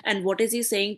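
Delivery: normal talking voice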